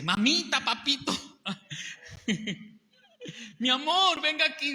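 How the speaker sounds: high, loud sweet-talking vendor; sugary market-seller pitch; coaxing seller. Retold or imitated. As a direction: coax the customer like a charming street seller.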